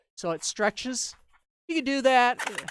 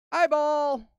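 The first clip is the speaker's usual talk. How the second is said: 1 calling out; 8 semitones higher, 7 dB louder, slower than usual. Real, not acted. Calling out next items.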